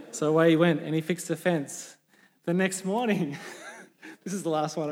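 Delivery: normal talking voice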